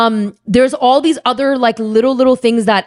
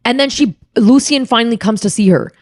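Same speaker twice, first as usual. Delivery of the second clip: usual speaking voice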